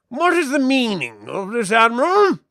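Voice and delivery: high, swooping voice of authority; imperious demand. Acted. High Arbiter confronting the Admiral on deck, imperious.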